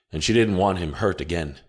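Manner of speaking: plain reading voice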